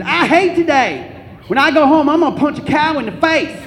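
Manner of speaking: put-on loud, high angry outburst with Southern accent; angry Southern teen. Steven arriving after school, angry Southern accent. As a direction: holler it like a fed-up Southern kid. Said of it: "he always had something angry to say".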